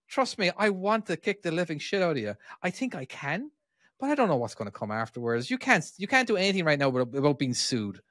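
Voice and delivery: ordinary talk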